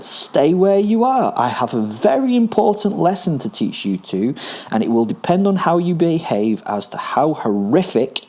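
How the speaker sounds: high, emphatic threatening order. A narrator's reading. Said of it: "I yelled".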